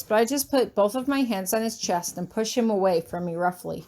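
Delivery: plain reading voice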